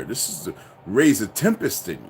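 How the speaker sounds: ordinary talk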